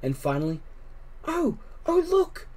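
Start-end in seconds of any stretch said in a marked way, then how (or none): 1.2-2.5 high, breathless delight; excited whisper. A narrator's reading. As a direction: gasp it out in wonder. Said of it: "Susan whispered".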